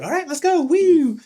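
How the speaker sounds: high, bright mock cheer; imitating the partner, high excited whoop; girlfriend's cheer. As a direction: cheer like a party girl. Kathy cheering to leave.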